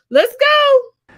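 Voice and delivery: high, loud, stretched-out rally call; hype shout. Real, not acted. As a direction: fire the crowd up to get going. Hyping the crowd to start.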